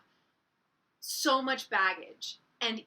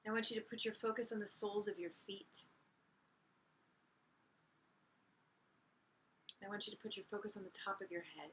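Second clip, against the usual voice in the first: guided meditation; 5 dB quieter than usual. Real, not acted. Teacher guiding listeners to breathe and picture light, guided meditation. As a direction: lead them inward gently, leaving long silences.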